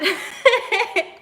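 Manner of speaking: high burst of laughter. Real, not acted. Laughing at the memory.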